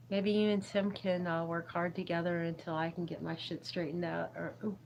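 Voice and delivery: ordinary talk, nothing marked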